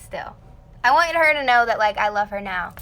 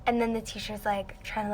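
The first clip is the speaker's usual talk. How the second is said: ordinary delivery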